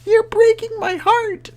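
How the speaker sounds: very high, slow, drawn-out imitation of a pleading heroine; mock-tragic high voice, stretching Anakin; Padmé's line. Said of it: "Instead of, uh, episode three".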